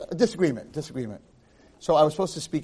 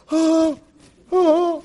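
shocked gasp; 12 semitones higher, 6 dB louder than usual. Retold or imitated. Girls react in shock.

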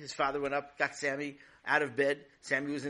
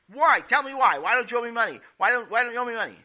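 high, loud, pleading demands; frantic demand; 9 semitones higher, 13 dB louder, faster than usual. Acted. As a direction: chase him down, begging why. Neighbor chasing the boy.

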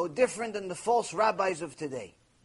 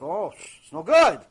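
slow, loud, lower-pitched imitation of objectors; mock disapproval; 4 semitones lower, 6 dB louder, slower than usual. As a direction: mimic people appalled at an idea. Mimics people objecting to slavery.

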